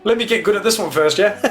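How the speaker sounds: mock outrage. Real, not acted. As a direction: playfully fake being horrified. Teasing protest at a bigger plane.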